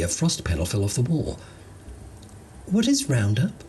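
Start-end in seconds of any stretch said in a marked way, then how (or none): 2.7-3.6 much higher puzzled alien; curious alien question, pitched up. Acted. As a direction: alien asking the handyman, high and puzzled.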